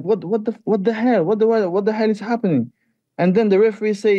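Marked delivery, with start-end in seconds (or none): 0.0-2.7 high, fast stunned outburst; shocked disbelief. Retold or imitated. Fighter retelling his shock at the stoppage, high and fast. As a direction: blurt it out in shock, rushing. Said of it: "I was like, you know, I was shocked".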